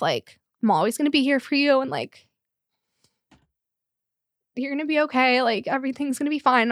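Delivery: fighting back real tears, voice lifting; real tearful catch. Real, not acted. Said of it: "it's gonna make me cry".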